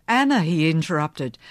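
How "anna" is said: hard, cold calling of her name; flinty. Acted. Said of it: "his voice was flinty now".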